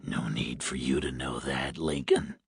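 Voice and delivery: low, hoarse, quiet menace; hoarse smoker's voice. A narrator's reading. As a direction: rasp it like a heavy smoker. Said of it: "The man sounded hoarse, like a heavy smoker".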